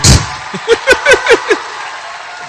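high, long laugh; drawn-out high laughter. Real, not acted. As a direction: break into a high-pitched cackle. Laughs at own line.

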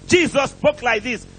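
ordinary talk, nothing marked

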